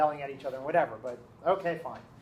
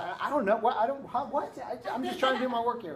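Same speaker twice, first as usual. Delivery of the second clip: higher, stammering imitation of bewilderment; flustered student; 6 semitones higher than usual. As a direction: stumble through it, imitating someone put on the spot. Cornered student dodges her questions.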